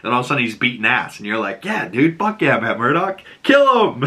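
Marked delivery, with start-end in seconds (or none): 1.6-4.0 cheering him on. Retold or imitated. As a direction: egg him on like a fan at the screen. Cheering at the screen.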